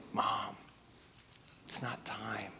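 hushed whisper to his mother. Retold or imitated. Jesus gently telling his mom it's not time, whispered. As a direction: drop to a breathy whisper.

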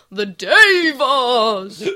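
loud, long drawn-out proclamation; creepy evangelist owl. Retold or imitated. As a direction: cry it out like a creepy preaching owl. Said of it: "a creepy evangelist owl".

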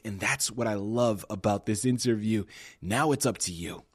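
ordinary delivery

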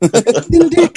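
high whiny cartoon kid; defensive Cartman whine.

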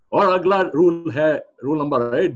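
booming, fast game show host voice; Amitabh Bachchan style. Acted. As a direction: announce it like a quiz show host. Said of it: "Amitabh Bachchan's Kaun Banega Crorepati style".

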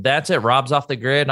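ordinary talk, nothing marked

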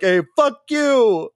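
slow, drawn-out put-on voice; hammed-up in-your-face farewell; mock blunt insult. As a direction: say it slow and unapologetic. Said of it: "straight up been like".

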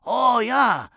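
loud, high-pitched Japanese accent impression; hammed-up Japanese accent, shouted and high; mock Japanese accent. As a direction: answer as an exuberant Japanese man. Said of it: "He was Japanese".

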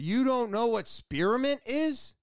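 mock-astonished, pitch jumping up; incredulous. Shop worker to customer who doesn't know spearmint, incredulous.

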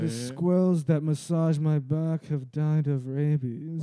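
slow, loud pampered royal complaint; foppish spoiled boy. Acted. Spoiled royal son whining to daddy, slow foppish voice. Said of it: "It's a very foppish".

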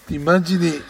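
usual speaking voice

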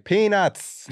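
high, loud vendor shout; ballpark vendor call. Retold or imitated. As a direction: call it out like a stadium peanut seller. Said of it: "sounds like you're at a baseball game".

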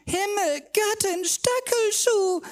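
very high, loud, slow yowl of pain; shrieking yowl; howling. Acted. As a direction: howl out the pain. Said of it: "jaulte sie".

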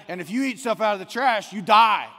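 warning shout. Acted. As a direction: shout it like a scolding pet owner. Warning the dog Izzy.

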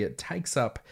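normal talking voice

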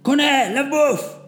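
high loud call. A narrator's reading. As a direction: call him over the chaos. Corporal calls out order.

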